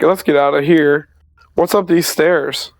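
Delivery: ordinary talk, nothing marked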